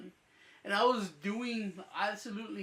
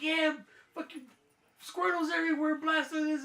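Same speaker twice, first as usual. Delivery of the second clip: higher, hyped-up friend bragging; excited friend; 8 semitones higher, 3 dB louder than usual. Retold or imitated. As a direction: gush like a buddy showing off his catches. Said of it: "and he's like".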